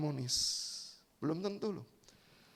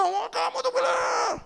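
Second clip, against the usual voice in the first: much higher shouted sneer; angry husband; slower than usual. Acted. Husband shouting sarcastically at his wife, angry. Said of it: "Suami masih marah".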